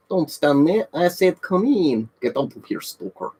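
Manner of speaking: hammed-up Ukrainian game-character accent; mock Ukrainian accent. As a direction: put on a fake Ukrainian accent. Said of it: "Do a stalker accent".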